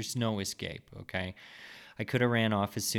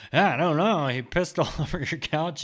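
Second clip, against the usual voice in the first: high, loud, blaming exclamation; loud accusing dad; 8 semitones higher, 9 dB louder than usual. Retold or imitated. Dad tells the family about the couch, loud and accusing. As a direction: point at the son and loudly disown him. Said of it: "pointing a fucking finger at me and saying".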